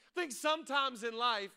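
ordinary talk, nothing marked

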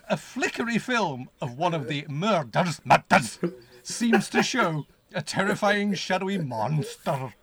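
loud, high, hammed-up horror narration; melodramatic blurb reading. Reading the blurb to the co-host, building melodrama. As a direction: build to a huge drawn-out climax.

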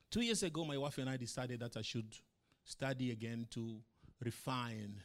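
ordinary talk, nothing marked

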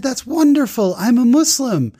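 very high, delighted exclamation; Abdulia. Retold or imitated. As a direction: announce it joyfully with pitch way up. Driver's delighted reaction, acted out.

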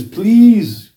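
begging. Retold or imitated. Companion begs Allah for a dying friend, imploring. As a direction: plead it as a retold prayer. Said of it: "begging Allah".

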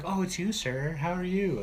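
high-pitched, cheerily voiced greeting; chirpy greeting. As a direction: play her greeting him in a high, sing-song voice. Acting the woman greeting the killer.

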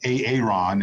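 "A Aaron" split as ay-AY-ron; Key and Peele pronunciation. Retold or imitated. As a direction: quote the comedy-sketch "A Aaron", both A's long. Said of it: "because of Key and Peele".

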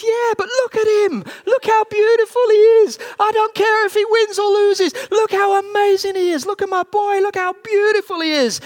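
high, loud overjoyed shouting; crying, rejoicing father. Retold or imitated. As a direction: imitate a dad yelling through tears of pride. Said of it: "he's crying and he's rejoicing".

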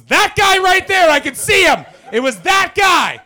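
loud, high insistent pointing out; excited shouting. Retold or imitated. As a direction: yell it, sure of yourself.

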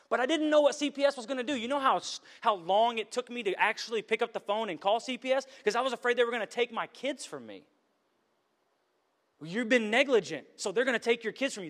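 put-on anxious retelling of the fear of losing the kids. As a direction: rush it out like the fear is back.

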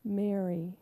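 soft and low, full of love; tender name. Acted. Jesus tenderly calling Mary by name, soft and low. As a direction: say her name softly, like a gift. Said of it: "he said one simple word".

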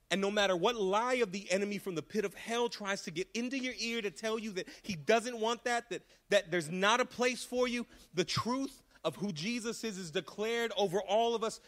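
loud declaration. Acted. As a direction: proclaim it over the whole church. Declaring truth at full force.

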